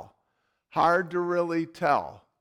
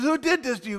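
higher, mock-concerned question; street guy voiced; 7 semitones higher, much faster than usual. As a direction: ask like a nosy hustler. Hat guy asks again.

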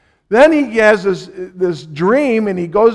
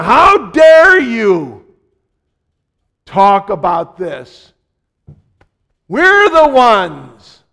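indignant protest; 2 semitones higher, 6 dB louder, slower than usual. Retold or imitated. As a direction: drag out the offended words.

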